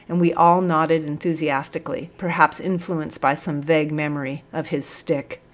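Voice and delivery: usual speaking voice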